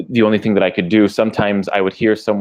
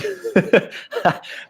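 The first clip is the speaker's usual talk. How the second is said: real laugh; 14 semitones higher than usual. Real, not acted. Laughs at own money habits.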